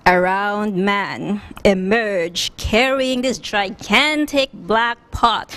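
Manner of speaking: plain reading voice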